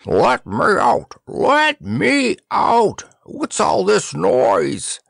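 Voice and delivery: high, very drawn-out cry; muffled-bag outcry. Acted. Head in a bag demanding release, high slow cry. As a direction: wail slowly to be released.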